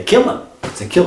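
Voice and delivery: sudden high jump on kill; mock shock. Comic explaining kill shelters, mock shocked. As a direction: pop up on kill in disbelief.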